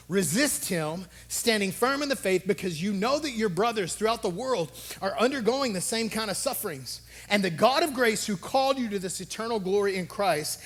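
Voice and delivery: fervent scripture reading. Acted. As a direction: proclaim the verse with growing force. Reads scripture with rising fervour.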